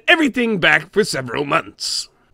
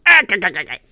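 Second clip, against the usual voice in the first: short choppy laugh from the throat; a little gurgling throat chuckle; small throat laugh; much faster than usual. Retold or imitated. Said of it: "did a small throat laugh at it, like this".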